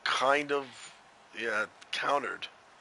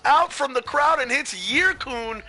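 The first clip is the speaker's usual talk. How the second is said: loud, high, shocked sports call; excited play-by-play; 11 semitones higher, 9 dB louder than usual. Real, not acted. Commentator calls a rock from the crowd, loud excitement. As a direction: shout the sudden hit to the crowd.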